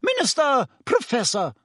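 high-pitched angry summons. A narrator's reading. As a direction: call both men to order angrily. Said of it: "said Madame Pomfrey angrily".

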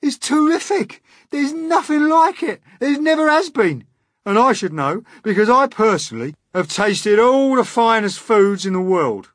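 very high, loud raving; crying out. A narrator's reading. Centipede boasting about the finest food, crying out. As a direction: rave at the top of the voice. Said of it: "the centipede cried".

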